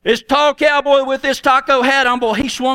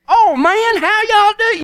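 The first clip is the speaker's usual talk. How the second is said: exaggerated cowboy hello in a much higher voice; Chris Doremus; 9 semitones higher, slower than usual. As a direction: imitate a Texas cowboy greeting folks, pitched way up. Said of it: "he talked like this".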